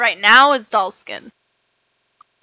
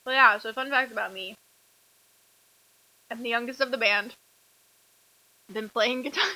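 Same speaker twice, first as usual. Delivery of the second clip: chewing while talking; 7 dB quieter than usual. Real, not acted. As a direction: mouth full of cookie. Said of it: "trying to talk while eating Oreos".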